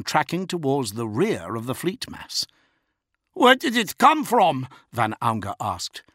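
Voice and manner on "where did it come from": alarmed. A narrator's reading. Alarm on bridge.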